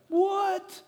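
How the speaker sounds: high-pitched incredulous "what?"; mock astonishment. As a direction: squawk it as a stunned listener. Acts astonished listener's reaction.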